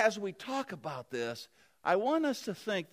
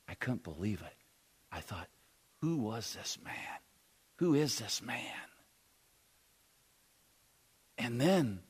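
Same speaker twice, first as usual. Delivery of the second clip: hushed, awed telling; 10 semitones lower, 5 dB quieter than usual. Acted. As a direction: tell it as if stunned by love. First-person Barabbas, hushed awe.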